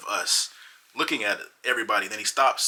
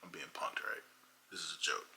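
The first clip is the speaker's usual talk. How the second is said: much softer, flat disbelief; muttered disbelief; 4 semitones lower, 14 dB quieter than usual. Retold or imitated. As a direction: say it under your breath, stunned. Speaker's disbelieving inner aside.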